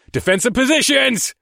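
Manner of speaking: high, loud battle command; shouting command. A narrator's reading. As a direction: bark the order loud. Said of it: "I shouted".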